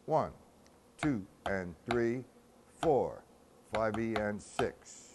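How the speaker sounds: slow, steady count in time; measured rhythmic counting; complete measure count. Shown as a demonstration. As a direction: count the whole measure out evenly.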